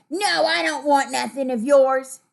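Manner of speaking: loud mock-rude refusal. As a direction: act out a rude no, with force. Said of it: "That would be rude".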